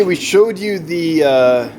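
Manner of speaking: normal talking voice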